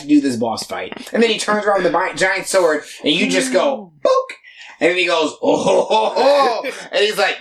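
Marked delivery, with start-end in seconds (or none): 4.0-4.3 high-pitched comic thwack; short, high sound effect for a hit; imitated impact noise. Retelling a giant sword swing, playful imitated impact. 5.4-6.7 rising, higher-pitched "oh-ho-ho"; mock delighted chuckle climbing upward; imitated gleeful chortle. Imitating a fighter thrilled by the attack, gleeful mock chortle. Acting out the character's gleeful reaction.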